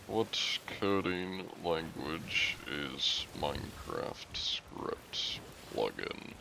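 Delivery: muttered whisper while typing. Real, not acted. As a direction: whisper it under your breath. Reading search aloud.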